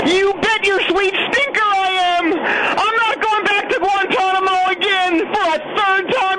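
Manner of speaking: high, ranting villain yell; manic, defiant shouting. Acted.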